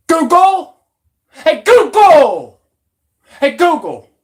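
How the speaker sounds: high, loud shout to Google; calling out loud. Real, not acted. Shouting at Google to get its attention, loud call. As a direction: bellow it like calling across a street.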